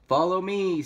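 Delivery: high, loud rallying call. A narrator's reading. Third pig leading brothers to roof, loud call.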